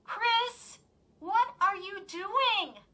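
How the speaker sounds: high, slow demanding call. A narrator's reading. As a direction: demand an answer. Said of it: "Lindy demanded".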